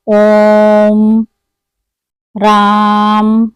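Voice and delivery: ओम and राम with the vowels stretched very long and slow, like a call; drawn-out pluta vowel. Shown as a demonstration. Hindi teacher showing students the pluta vowel, slow long call. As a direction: call ओम, राम as if to someone far off, holding each vowel. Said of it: "यह उच्चारण प्रायः दूर से पुकारते समय होता है".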